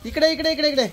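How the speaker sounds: high, rapidly repeated beckoning. Real, not acted. Father coaxing child into the rain, high-pitched calling.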